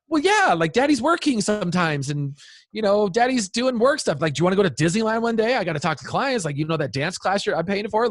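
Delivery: high-pitched, flustered self-justifying; defensive dad. Retold or imitated. Dad justifying phone use to his daughter, retold defensive protest. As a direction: re-enact scrambling for excuses to your kid.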